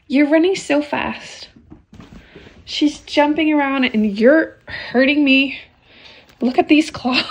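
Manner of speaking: talking to her kittens, cooing. Real, not acted. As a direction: coo at the kittens. Baby talk to kittens.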